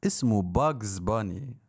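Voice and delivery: ordinary talk, nothing marked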